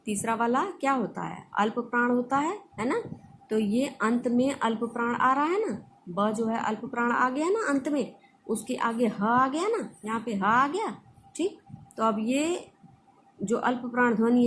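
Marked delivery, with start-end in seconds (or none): none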